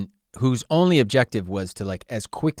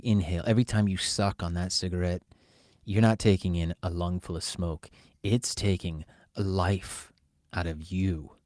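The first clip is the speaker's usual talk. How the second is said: PSA announcer; 2 semitones lower than usual. Pretend. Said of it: "This message brought to you by".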